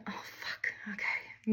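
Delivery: breathy whispered dread, barely audible; whispered aside. Retold or imitated. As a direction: mutter it under your breath like a secret. Private panic aside to audience.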